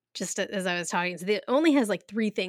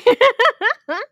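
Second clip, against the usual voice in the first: high, loud burst of laughter; 12 dB louder than usual. Real, not acted. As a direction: laugh out loud until it squeaks. Big laugh after congrats.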